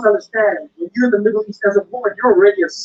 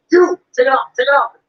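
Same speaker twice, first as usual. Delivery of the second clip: mock shouting guard; 5 semitones higher, 4 dB louder than usual. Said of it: "They're shouting at you".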